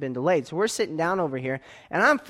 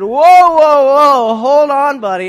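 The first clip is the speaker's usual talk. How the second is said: loud, high blustering shout; puffed-up shout; 10 semitones higher, 16 dB louder, slower than usual. Retold or imitated. Man puffing up at the airline desk, shouting. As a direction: puff up and shout him down. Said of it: "I got my arms going".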